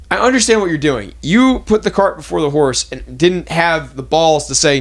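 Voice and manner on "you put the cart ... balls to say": ordinary delivery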